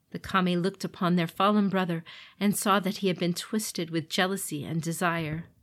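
ordinary delivery

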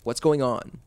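panicking rising voice; alarmed. A narrator's reading. Babysitter alarmed.

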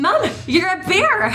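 high, slow mock Scottish accent. Cohost repeating it despite the host's plea, high Scottish accent. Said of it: "do my horribly offensive Scottish accent".

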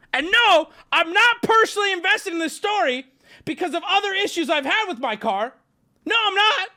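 high, loud, cracking voice; defensive squeak into a crack. Real, not acted. Streamer to chat, voice cracking defensively. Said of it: "Ignore the voice crack".

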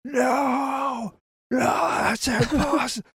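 high, hammed-up cry of denial; mock shout. Mark refusing the twist, hammed-up high wail. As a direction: wail it like a shocked hero. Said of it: "Mark is like".

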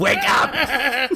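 yelling through laughter; laughing shout. Pretend. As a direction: laugh while you yell it. Acting out tank staff yelling.

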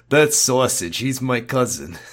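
usual speaking voice

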